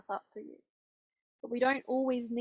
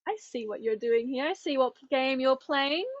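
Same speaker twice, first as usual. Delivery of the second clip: knowing call-out; 3 semitones higher, 6 dB louder than usual. Retold or imitated. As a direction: call their bluff, stretching it out. Knowingly calling out her own thoughts.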